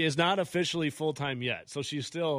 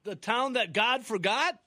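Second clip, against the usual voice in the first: drawn-out, higher mocking question; teasing nickname; 8 semitones higher, slower than usual. Real, not acted. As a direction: tease the little town slowly.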